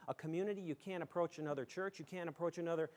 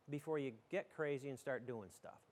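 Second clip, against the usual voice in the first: normal talking voice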